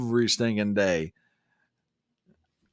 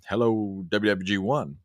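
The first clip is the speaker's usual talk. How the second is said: ordinary talk, nothing marked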